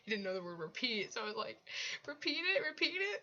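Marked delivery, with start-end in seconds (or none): 2.1-3.2 much higher, retold coaxing; high-pitched prompting. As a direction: pipe it up as an eager prompt. Retells her eager prompting of him.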